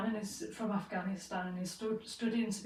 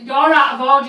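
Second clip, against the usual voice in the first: much louder and higher, furious; angry shout; 5 semitones higher, 17 dB louder than usual. Retold or imitated. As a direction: tell her off at full volume. Shouting at racist host mother.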